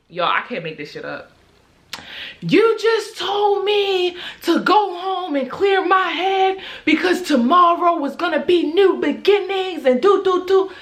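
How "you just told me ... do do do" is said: high, loud, slowed mock rant; mock screaming girl. The jilted girl yelling at him in the garage, high mock shout. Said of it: "she like".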